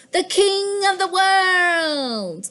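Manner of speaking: high, slow, regal stretch; a cat crowning itself ruler of the world; grand royal proclamation. Acted. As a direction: proclaim it like a king. Cat declares itself king.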